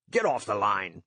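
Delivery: ordinary delivery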